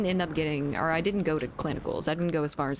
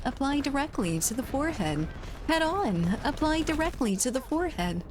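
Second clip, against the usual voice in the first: high, bright commercial announcer read; pharmaceutical ad; 7 semitones higher than usual. Acted. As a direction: sell it like a TV ad. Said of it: "You have a drug commercial voice?".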